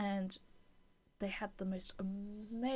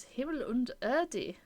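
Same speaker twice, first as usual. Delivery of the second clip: slow, higher-pitched mock German accent; put-on German accent; 3 semitones higher, 5 dB louder, slower than usual. Shown as a demonstration. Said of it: "I'm sorry about the accent".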